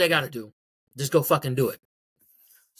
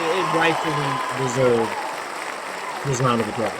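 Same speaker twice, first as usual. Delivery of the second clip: mock applause; 2 semitones lower than usual. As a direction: deliver it like a grand award. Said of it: "let me give it one of these".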